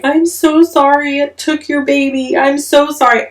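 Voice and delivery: high, pleading apology to the hen; tearful apology. Retold or imitated. Apologizing to the mother chicken, high and pleading. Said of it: "I'm apologizing out loud".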